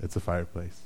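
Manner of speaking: low, soft and flat with boredom; bored deadpan. Retold or imitated. Staring at the fireplace, bored low mumble. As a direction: sigh it like it's lost its thrill. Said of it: "you're just like".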